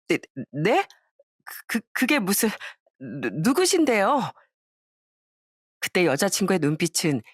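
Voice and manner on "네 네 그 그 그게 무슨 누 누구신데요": mildly panicked, halting stammer; a touch of a caught liar stumbling over words; flustered stammer. Acted. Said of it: "눈빛은 정말 쉴 새 없이 흔들리고". (살짝 당황해 더듬는 말투)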